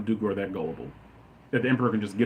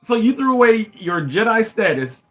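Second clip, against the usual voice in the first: higher, louder, rushing by the end; indignant rant; 8 semitones higher, 6 dB louder than usual. Real, not acted. Fan blasting prequel writing, loud and indignant. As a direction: tear into the bad writing. Ranting about Anakin's fall.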